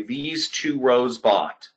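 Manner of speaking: slow and deliberate; slow emphatic. Real, not acted. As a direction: spell it out slowly. Repeating key result for emphasis.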